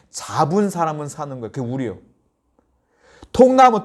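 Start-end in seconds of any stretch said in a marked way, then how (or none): 3.3-3.8 high, loud exclamation; exclaimed. Real, not acted. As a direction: hit the word hard. Preacher exclaims key word.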